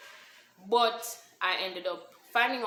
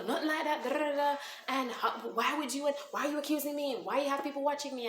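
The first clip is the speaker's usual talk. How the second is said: defensive denial; 8 semitones higher, 4 dB louder than usual. Retold or imitated. Boyfriend's defensive denial retold.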